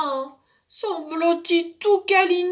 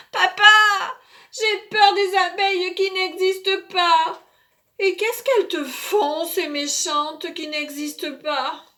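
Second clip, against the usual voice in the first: put-on high small child's voice, frightened; a little kid afraid at night; scared little boy; 5 semitones higher, 5 dB louder than usual.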